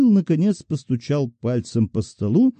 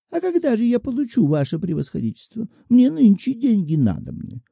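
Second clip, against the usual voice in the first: a whining widow threatening with her rights; defenceless petitioner; 10 semitones higher than usual. A narrator's reading. Old petitioner threatening the clerk, indignant and much higher. Shchukina voiced by narrator.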